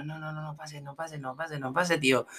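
very soft, rapid little voice; pleading teeth. Acted. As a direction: murmur it like teeth blocking the air. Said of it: "tu diente está diciendo". (vocecita bajita que repite que no pase)

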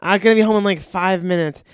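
loud, high mock reply; imitating partner's reply. Mimics her maddening slow answer.

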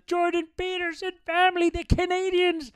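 sarcastic grand proclamation; mock announcer flourish. Mocking the family.

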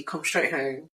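ordinary talk

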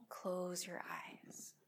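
slow, soft whispered relaxation; soft spa whisper. Acted. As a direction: whisper them into stillness. Relaxation cue lying down.

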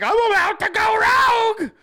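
very high, loud shout; mock shout. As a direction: yell like he's snapping. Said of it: "He's like".

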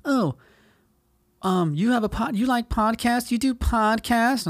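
high-pitched, cooing mock questions; mocked podcast fans. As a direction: mimic an over-interested stranger up high. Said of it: "first thing they say all the time".

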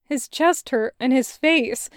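ordinary delivery

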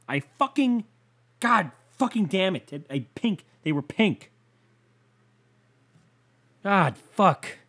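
high, exasperated swearing; worked-up, halting exasperation; frustrated outburst. Real, not acted. Venting over ruined laundry.

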